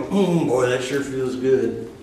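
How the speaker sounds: slow, pleased savoring murmur; contented sigh. Retold or imitated. Preacher imitating people enjoying good things, slow contented murmur. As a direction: savor it, drawn out. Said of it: "and you go".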